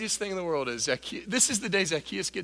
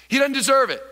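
loud take; 9 semitones higher, 7 dB louder than usual. Shown as a demonstration. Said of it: "Say it".

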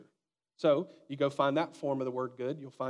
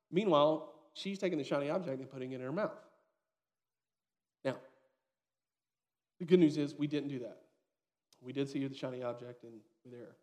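ordinary delivery

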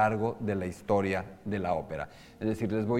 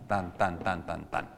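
every "tan" the same short length; even syllables; 4 semitones lower, 4 dB quieter, slower than usual. Shown as a demonstration. Teacher shows equal syllable length. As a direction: tap out even beats, tan tan tan. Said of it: "llevan en la misma duración".

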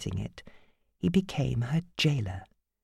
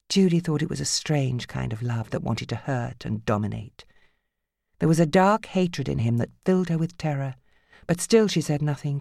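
ordinary delivery

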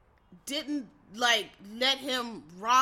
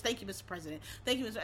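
soft, lower polite thank you; timid mock of the moderator; meek thank you; 3 semitones lower, 7 dB quieter, much faster than usual. Moderator softly thanking instead of stopping Pence. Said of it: "all she was saying was".

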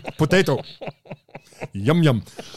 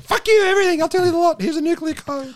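panicked captive. Pretend. Captive spilling everything to interrogators in a high shriek. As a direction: shriek out the secrets in a hurry. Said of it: "they're like".

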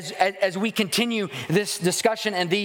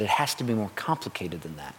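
low inner doubt; 8 semitones lower, 5 dB quieter than usual. Retold or imitated.

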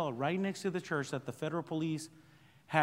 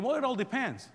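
higher playful evasive reply; wry dodge; 8 semitones higher than usual. Retold or imitated. Pastor joking to the congregation, higher and wry. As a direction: toss it off with a joking shrug. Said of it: "I wanted to say again".

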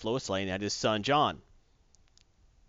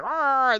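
mock monster roar; 12 semitones higher, 6 dB louder than usual. Sandworm attacks.

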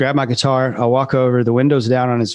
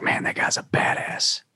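hushed, breathless admiration; awed whisper; 7 dB quieter than usual. Retold or imitated. Guy telling a friend about a badass, in a whisper. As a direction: whisper it like you're in awe.

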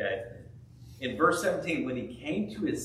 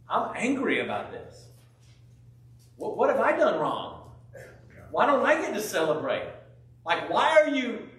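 aggrieved complaint; 6 semitones higher than usual. Retold or imitated. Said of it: "of going".

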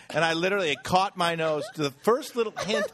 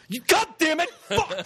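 high, loud mock outburst; angry cursing; 5 dB louder than usual. Dad cursing his own fart, high loud mock outburst. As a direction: swear like a furious dad.

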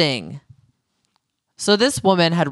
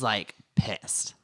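ordinary talk, nothing marked

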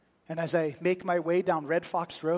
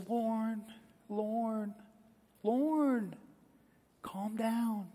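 higher-pitched, drawn-out name, gently repeated; soothing; 4 semitones higher, 3 dB quieter, much slower than usual. Retold or imitated. Missus McManus calms the crying boy on the phone, soothing. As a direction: coax a crying child to settle.

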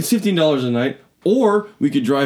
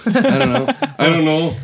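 laughing dodge; 11 semitones higher, 4 dB louder than usual. Real, not acted. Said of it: "embarrassed repeat, laughing".

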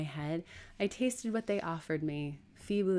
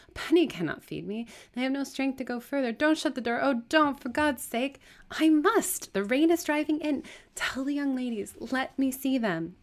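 high, loud, urgent begging; desperate pleading; 10 semitones higher, 8 dB louder than usual. Acted. Beggar pleading as the door closes, desperate. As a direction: beg frantically as your last chance slips away.

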